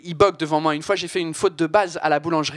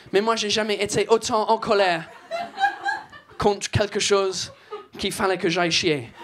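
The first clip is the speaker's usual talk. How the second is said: French with a put-on English accent. Comedian riffing on swearing in an English accent. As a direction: keep the Brit accent going. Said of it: "cet accent de merde".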